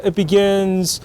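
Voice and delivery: normal talking voice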